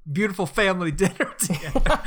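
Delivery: giggling through the words; laughing. Real, not acted. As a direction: laugh while saying it. Laughing about rice commercial.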